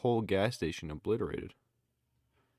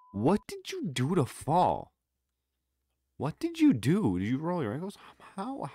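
incredulous; 8 semitones higher, 6 dB louder than usual. Real, not acted. Incredulous at the fall.

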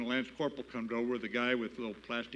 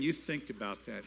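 usual speaking voice

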